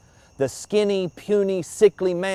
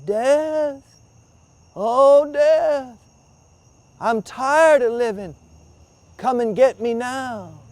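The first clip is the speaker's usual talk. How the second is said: higher, louder feeble call for Death; 8 semitones higher, 3 dB louder, slower than usual. Acted. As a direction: try harder to be heard, straining. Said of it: "he called out again".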